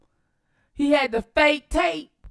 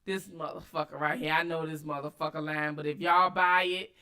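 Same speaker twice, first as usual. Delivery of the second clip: low, quiet sceptical aside; deadpan, low-pitched imitation of her thinking; imagined inner thoughts; 10 semitones lower, 7 dB quieter than usual. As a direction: voice her private doubts low and under the voice. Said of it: "She like".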